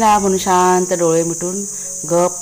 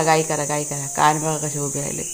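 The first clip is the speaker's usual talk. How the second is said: softer, lower hush for the dogs; lulling to sleep; 4 semitones lower, 6 dB quieter than usual. Real, not acted. Woman lulls her dogs to sleep, softer and lower.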